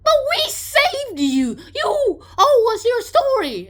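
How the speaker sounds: high, loud, slow outrage; indignant demand. Retold or imitated. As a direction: insist they are owed. Crowd demanding the story.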